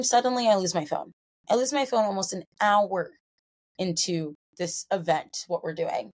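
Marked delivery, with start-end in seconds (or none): none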